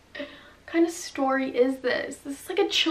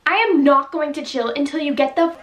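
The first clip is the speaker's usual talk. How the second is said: angry shout; 3 semitones higher, 7 dB louder than usual. Acted. Girl shouts at the intruder, furious. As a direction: shout him down.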